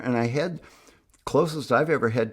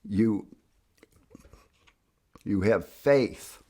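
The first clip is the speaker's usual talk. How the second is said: ordinary talk, nothing marked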